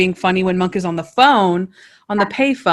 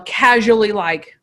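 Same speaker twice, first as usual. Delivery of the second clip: ordinary delivery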